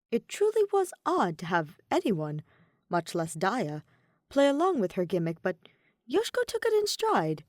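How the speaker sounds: ordinary delivery, nothing marked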